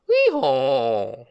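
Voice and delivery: loud playful hee-haw; donkey bray. Pretend. Donkey sound for children.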